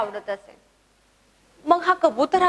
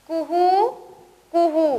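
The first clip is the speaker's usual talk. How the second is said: hooting "kuhoo", first higher and rising; cuckoo call; 3 semitones higher, slower than usual. Shown as a demonstration. Teacher voicing koel for pupils to repeat, rising hoot. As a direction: coo like a koel bird. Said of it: "माझ्या मागं म्हणायचंय".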